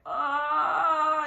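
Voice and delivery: long, loud, high "ah"; sustained demonstrative yell of pain; reenacted scream. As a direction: cry out in acted labor pain. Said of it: "I'm, like, screaming".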